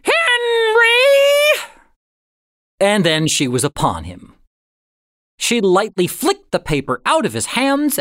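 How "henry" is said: much higher, louder shrieked call; shrill shout. A narrator's reading. Agnes shouting for Henry to catch him reading. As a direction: screech his name across the house. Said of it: "Agnes's voice".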